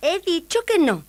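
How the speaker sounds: high, firm princess; cartoon princess. A narrator's reading. Princess refuses again. (princesa firme con voz aguda)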